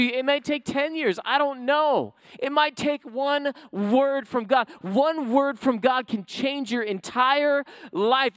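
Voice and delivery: loud, rising preacher's proclamation; preaching at full force. Acted. Pastor urging the congregation that God mends hearts, full-force preaching. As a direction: build the sermon to its peak.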